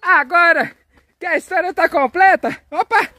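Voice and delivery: high, excited wrap-up; jubilant announcement. Pretend. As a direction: announce the happy ending in a high, celebratory voice. Celebrates the completed story. (anúncio festivo, voz aguda e animada)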